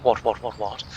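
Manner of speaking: quickfire royal English "what, what"; mock royal. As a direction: ham up the posh aristocrat. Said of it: "I've turned into Prince Charles".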